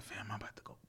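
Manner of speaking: hushed whisper to himself; unvoiced whisper of resolve; whispered thought. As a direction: breathe it like a private thought. Retold inner thought, whispered.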